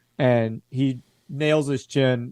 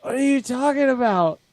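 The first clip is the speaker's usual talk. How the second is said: much higher, strained bewildered voice; a dazed soldier asks, high and bewildered; confused soldier; 11 semitones higher than usual. Acted. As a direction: squeak it out like a dazed man.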